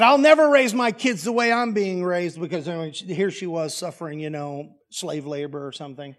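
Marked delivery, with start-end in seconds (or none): 0.0-2.4 louder and higher, an indignant shout of protest; defiant teen vow. Retold or imitated. Teen daughter yelling at her father: defiant loud vow. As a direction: fling the vow back at dad.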